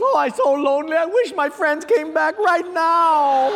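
high, hammed-up lonely wail; Portuguese joke character. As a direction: whine the wish loudly, slowing into the punchline. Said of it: "the Portuguese goes".

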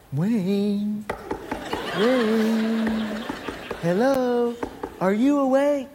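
a lightly voiced friend at the door; Mike calling softly. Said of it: "And it was Mike".

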